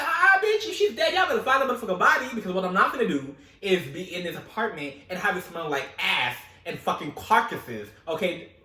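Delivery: loud, fast, laughing outburst; hammed-up angry yell at the family; mock furious rant. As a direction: tell them off, half laughing.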